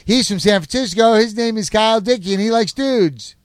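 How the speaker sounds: upbeat host announcing the bachelors; game show host. Acted. Game show host presents bachelors to the audience, upbeat host voice. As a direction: introduce each contestant like a TV host.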